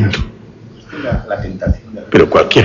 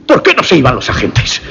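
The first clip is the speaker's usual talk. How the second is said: ordinary delivery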